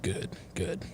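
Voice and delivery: low, hushed father delivering grave news; disappointed father. Acted. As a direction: speak softly and low, as a father rejecting his daughter. Acts a father disowning daughter.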